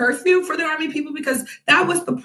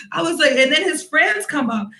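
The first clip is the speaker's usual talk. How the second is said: normal talking voice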